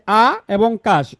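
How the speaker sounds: আ and কাশ drawn out slowly as two parts; slow split of the word into আ, then কাশ; syllables separated. Shown as a demonstration. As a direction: give আ and কাশ each its own slow beat. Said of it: "আকাশ শব্দে দুইটা অক্ষর আছে".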